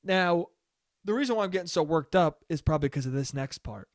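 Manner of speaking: usual speaking voice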